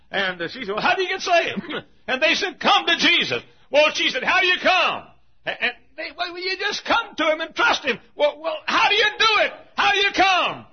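high, loud, worked-up storytelling; full-force preaching. Acted. Little lady asking how to come, high insistent shouting. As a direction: act out the exasperated exchange at full voice.

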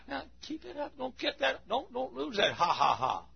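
high voice spoken through laughter; laughing encouragement. Retold or imitated. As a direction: urge them on while still laughing. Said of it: "he'd sit in the choir and go".

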